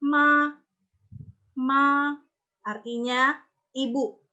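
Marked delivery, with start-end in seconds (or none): 0.0-0.5 "ma" as one steady sung-level tone; first-tone MA. Shown as a demonstration. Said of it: "Dia datar kan?". 1.6-2.1 "ma" as one steady sung-level tone; first-tone MA. Shown as a demonstration. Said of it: "Dia datar kan?".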